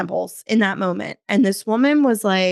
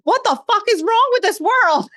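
exasperated; 12 semitones higher than usual. Real, not acted. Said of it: "I cannot believe".

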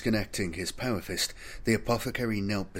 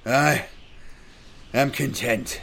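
strained, higher voice with a long break; failing voice; 5 semitones higher, 4 dB louder, slower than usual. A narrator's reading. Dying sergeant gives his final answer, failing and strained. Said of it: "his voice barely a whisper".

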